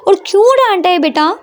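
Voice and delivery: high, fierce protective mother; furious at the teacher, demanding; angry. A narrator's reading. Mother angrily demands who scolded her daughter, high and fierce. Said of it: "वो गुस्से में बोलती है".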